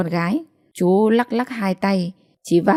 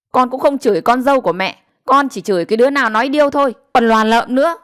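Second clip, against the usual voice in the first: higher, rapid, heated comeback; angry retort; 5 semitones higher, 5 dB louder, faster than usual. A narrator's reading. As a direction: fire back at mother-in-law, getting louder. Daughter-in-law retorts in the quarrel.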